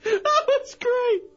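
high, breathless laughter through words; crying with laughter; laughing hard. Real, not acted. Host cracking up after prank.

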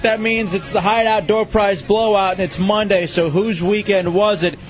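upbeat radio host read; energetic on-air promo; radio contest promo. Acted.

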